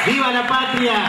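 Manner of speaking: booming balcony speech. Real, not acted. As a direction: cry it out to the crowd at full voice. Repeated rally cry, climax.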